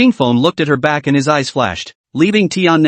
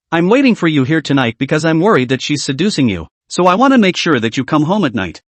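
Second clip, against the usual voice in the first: usual speaking voice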